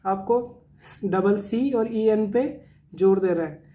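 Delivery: ordinary delivery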